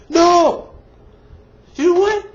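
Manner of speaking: high, loud indignant no; angry outburst. Real, not acted. Reviewer denying the hoped-for plot, angry and loud. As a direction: fire it back, fed up.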